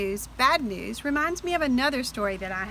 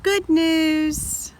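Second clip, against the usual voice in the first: bright cheerful good news, pitched up; happy; 5 semitones higher than usual. Shown as a demonstration. Teacher reading to children, delighted. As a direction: cheer it like a gift.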